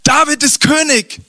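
high, slow urgent announcement of the deaths; urgent messenger. Acted. Messenger bursting through the door with terrible news, urgent and high.

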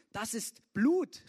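Moabites cry out. A narrator's reading. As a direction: cry it out as the Moabites seeing blood. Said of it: "riefen die Moabiter".

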